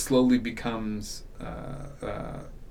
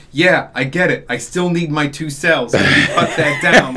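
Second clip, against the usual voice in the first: louder, higher-pitched protest; lightly acted lawman pushing back; put-upon sheriff, raised voice; exasperated sheriff; 4 semitones higher, 9 dB louder than usual. Acting out exasperated sheriff's reply.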